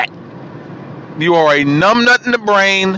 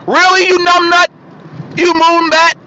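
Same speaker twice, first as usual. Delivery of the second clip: sudden much higher, louder outraged cry; outraged yell; 11 semitones higher, 3 dB louder than usual. Real, not acted. Ranting at Pelosi over abortion, outraged higher louder yell. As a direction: blast the insult out in disbelief.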